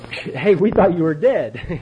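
loud, slow exclamation of surprise; mock astonishment. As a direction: imitate people stunned to see him alive. Acting people surprised to see him.

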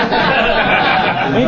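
real high laugh at his own story. Real, not acted. Speaker laughing at his sponsor story, high and real. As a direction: break into a laugh.

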